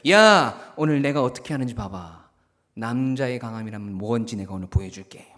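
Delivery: loud hey, then low boastful talk; swaggering bravado. Acted. Boy boasting to his friends on the way over, swaggering. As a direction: show off to your friends, dropping low after the call. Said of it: "막 이러면서 헛세를 떨면서".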